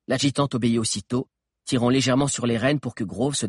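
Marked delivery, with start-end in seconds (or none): none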